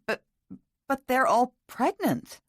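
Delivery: high, halting stammer of disbelief; flustered, high-pitched stammering protest. A narrator's reading. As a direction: stumble on the first word, then protest, drawn out. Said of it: "Catherine stammered".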